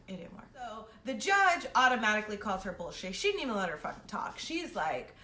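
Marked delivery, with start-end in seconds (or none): none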